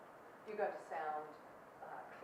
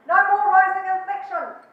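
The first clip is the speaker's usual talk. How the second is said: loud, high, slow proclamation; mock stern command; 9 semitones higher, 20 dB louder than usual. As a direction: call it out like an order to the whole room. Coach mock-ordering the audience to stop.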